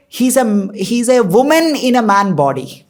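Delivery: normal talking voice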